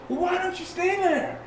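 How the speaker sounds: high, scornful jab; sneering cop. Retold or imitated. Officer mocking the man pinned down, high sneer. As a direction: toss it off with a high-pitched sneer.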